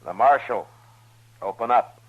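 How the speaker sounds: firm, loud announcement at the door; calling through a door. Acted. Marshal knocks on a door.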